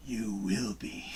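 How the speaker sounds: low, slow Yoda voice. Retold or imitated. Reading a viewer's comment back in a slow Yoda voice. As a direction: croak it out slowly like Yoda. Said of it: "Did you say that like Yoda?".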